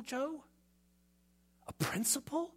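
high, soft incredulous gasp; mock shock. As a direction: act scandalised by the question.

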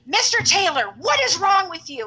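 high, loud scolding teacher; stern teacher. Retold or imitated. Mean teacher scolding the screaming boy, loud and high. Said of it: "she's like".

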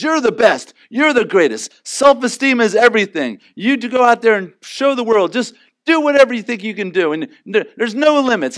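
a sarcastic pep-talk voice; mocking cheerleader. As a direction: parody the empty hype voice. Mocking self-esteem culture.

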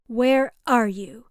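loud, slow, sharp demand down the phone; snapped. A narrator's reading. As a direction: bite off the question, impatient. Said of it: "she snapped".

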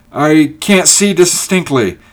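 high, loud, drawn-out words; straining to see. A narrator's reading. Ulrich peering at the approaching figures, straining to see. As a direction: stretch it out while peering into the distance.

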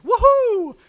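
high, very loud hammed-up woohoo; cartoon whoop. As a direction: whoop like you just came back to life. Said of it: "pops up and ... and then goes".

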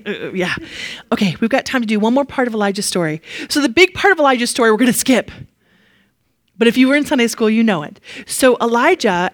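usual speaking voice

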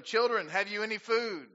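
a touch of calling out; Jesus calling. Retold or imitated. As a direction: call across the water. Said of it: "Then Jesus said to them".

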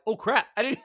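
high, loud mock panic; hammed-up alarm; panicked oops. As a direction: blurt it out like you just made him cry. Flustered friends, he cries.

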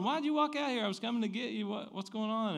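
high, exasperated calling out; imitating a baffled husband's yell; retold yelling. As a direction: yell puzzled questions down the street. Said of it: "I was yelling".

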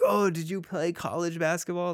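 put-on impressed kid. Kids impressed by two shots.

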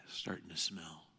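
unvoiced whisper of a grim detail. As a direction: breathe it out as a secret. Whispered detail of dead body.

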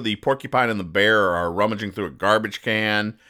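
ordinary talk, nothing marked

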